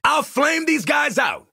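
high, drawn-out battle yell; combat shout. Acted. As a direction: holler it over the fight. Combat bark in a game.

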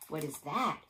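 ordinary delivery, nothing marked

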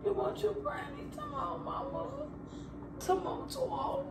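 high, soft sing-song coaxing. Real, not acted. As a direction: coax the little one over gently. Coaxing a pet or baby.

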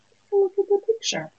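usual speaking voice

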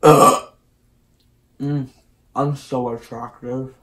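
chewing while talking; muffled through food; mouth full. Real, not acted. Seen 1: Food visibly in mouth at start, then chewing with lips pursed.

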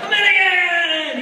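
loud, high, drawn-out mock scream. Stranger hugging the American tourist, screaming. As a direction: imitate an overjoyed stranger yelling in greeting. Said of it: "wrapped around me screaming".

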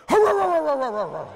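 high loud growling hurrs, laughing; jump-scare growl to scare Dad; playful monster growl. Retold or imitated. Son leaping from the bushes at Dad, growling loud. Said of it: "he goes".

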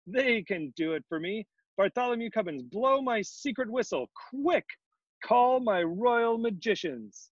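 high, excited royal orders; gleeful king. A narrator's reading. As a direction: call out commands, delighted with the idea. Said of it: "he began laughing".

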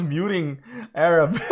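words bursting through laughter; laughing. Real, not acted. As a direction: laugh while confessing the blunder. Laughing at own mistake.

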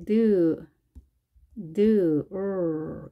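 드 and 을 as separate English D and R sounds; sounds separated. Shown as a demonstration. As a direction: draw out 을 as a curled R after 드. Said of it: "이렇게 쓰시고".

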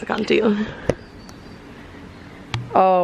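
ordinary talk, nothing marked

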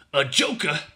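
loud, scornful snort of a question. A narrator's reading. Hungry soldier mocking his mate's excuse, snorting. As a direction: throw the word back with a snort. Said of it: "snorted the other".

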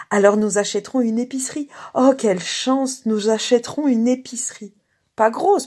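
high, loud, delighted; cry of joy. A narrator's reading. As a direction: exclaim with joyful excitement. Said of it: "Elle poussa un cri de joie".

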